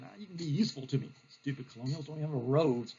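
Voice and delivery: low, soft aside under the breath; scornful officer muttering to himself; grumbling general. Acted. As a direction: grumble it low, half to yourself. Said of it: "And Bulldog".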